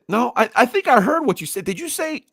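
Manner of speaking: high-pitched, incredulous challenge; indignant confrontation. Retold or imitated. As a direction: confront them over what you overheard. Retold confrontation with the coach.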